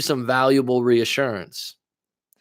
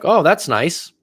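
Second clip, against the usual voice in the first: lightly sarcastic retold compliment; 2 semitones higher than usual. Comedian reacting to the rapper's self-portrait, lightly sarcastic. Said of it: "like, a sarcastic comment about it".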